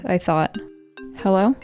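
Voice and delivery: ordinary delivery, nothing marked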